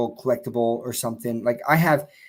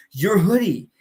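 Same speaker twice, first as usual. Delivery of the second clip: put-on higher, louder Boston-accented fan; 7 semitones higher, 4 dB louder than usual. Stranger explains it's the Boston hoodie, mild Boston accent. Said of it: "he's got this Boston accent".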